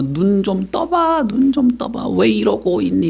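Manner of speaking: high, slow wailing plea; imitated tearful pleading. Family begging the dead to wake, acted high wail. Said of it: "이러면서 막 운단 말이야".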